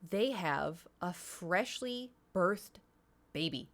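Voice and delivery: slow, spaced-out delivery; dramatic reveal. Real, not acted. Streamer revealing the worst part to viewers, slow deliberate reveal. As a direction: draw each word out, then drop on the last.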